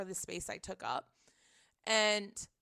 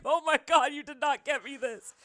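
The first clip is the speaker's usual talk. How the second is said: retold gift reaction, pitched up and louder at first; overjoyed disbelief; 4 semitones higher, 6 dB louder than usual. Friend receiving a gift basket, mock-scandalized delight.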